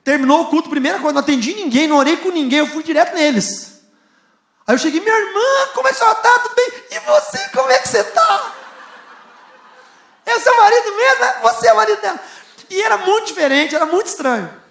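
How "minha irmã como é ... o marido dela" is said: high, loud, gushing hello to the sister and husband; excited greeting. Retold or imitated. Pastor greeting the restored couple, high and overjoyed. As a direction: bubble over with joy as you greet them.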